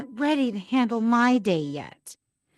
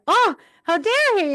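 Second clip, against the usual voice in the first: high, louder mock outrage; hurt indignation; 11 semitones higher, 5 dB louder than usual. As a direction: act affronted, pitch leaping up. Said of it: "I felt hurt".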